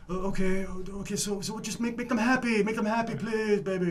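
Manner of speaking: high, sing-song pleading boss voice; lightly voiced boss. Imitating his boss begging him to please the customers, high and pleading. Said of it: "And he's like".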